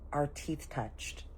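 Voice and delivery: very soft and slow; hushed confession. Retold or imitated. Girl confessing a kiss mishap to auntie, slow hush. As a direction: confide it slowly, almost in a whisper. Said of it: "whispers in my ear".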